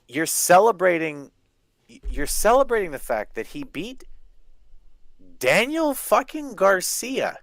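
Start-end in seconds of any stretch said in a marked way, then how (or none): 5.4-7.4 high, drawn-out name in disbelief; slow, high-pitched contempt; incredulous scorn. Real, not acted. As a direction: stretch each word, pitched way up. Incredulous at who was beaten.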